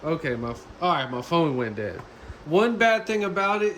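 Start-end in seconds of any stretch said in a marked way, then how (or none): none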